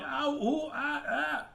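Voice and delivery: high-pitched drawn-out ows; yelling in pain. Acted. Something yelling under a pile of flies, high pained wailing. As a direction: wail "ow, ooh" way up high. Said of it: "something was yelling".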